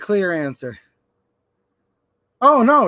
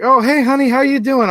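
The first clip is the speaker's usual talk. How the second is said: higher, louder sweet greeting; doting dad; 6 semitones higher, 4 dB louder than usual. Retold or imitated. Dad greeting his eight-year-old daughter on the phone, a sweet higher greeting. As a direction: brighten up for the little girl.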